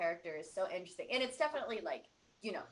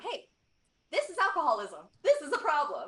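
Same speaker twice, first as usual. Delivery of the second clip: raised, higher voice, driving the point home; emphatic declaration; 7 semitones higher, 7 dB louder than usual. Retold or imitated. Director to the audience about alcoholism, emphatic declaration. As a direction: imitate a director loudly sounding the alarm. Voicing a director's blunt message.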